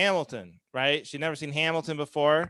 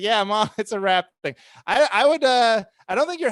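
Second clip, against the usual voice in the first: mock-patient reply through laughter; laughing reply; 6 semitones higher, 5 dB louder than usual. Son answering mom's Hamilton text, laughing. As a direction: chuckle while explaining to mom.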